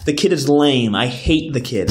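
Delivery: ordinary talk, nothing marked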